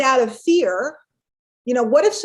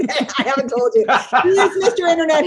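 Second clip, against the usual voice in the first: laughing loud and fast; giggling disbelief at Mister Internet; 4 semitones higher, 6 dB louder, faster than usual. Real, not acted. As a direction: tease him through laughter. Teasing friend over his claim.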